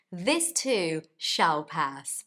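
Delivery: slow, deliberate delivery; slow catchphrase. Shown as a demonstration. Teacher repeating her motto slowly.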